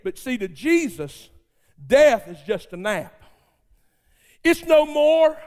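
normal talking voice

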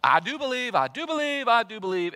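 mock the proud believer who protests too much; prideful. Preacher mocking the proud believer, insistent and loud. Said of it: "overly prideful approach that goes".

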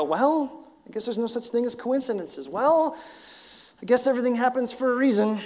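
high, airy shrug of a reply; mock shrugging cliché. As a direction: toss it off like a cheap platitude. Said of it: "shrug our shoulders and go".

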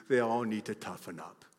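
scornful, eye-rolling mockery; dismissive older person. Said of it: "rolled their eyes at me and said".

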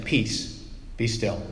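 soft, brief, plain command; just say it, no drama; an understated quiet rebuke; quiet matter-of-fact command. Retold or imitated. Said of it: "records Jesus' words as just saying".

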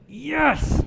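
high, gloating mock cheer; a drawn-out, self-satisfied "yes"; gleeful yes. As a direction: crow it as if cheering someone's downfall. Gloating at speeder pulled over.

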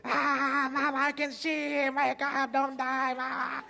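put-on the girl begging her fainted mother; frantic little girl. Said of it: "just dives at her".

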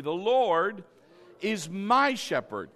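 loud call-out, MY punched; stress on MY. Shown as a demonstration. Preacher leading everybody to say it with him, stressing MY. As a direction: lead the line firmly, hitting "my". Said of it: "Everybody say that with me".

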